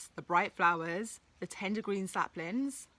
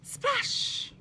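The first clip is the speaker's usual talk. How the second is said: splash sound. Acted. Troll falls into river.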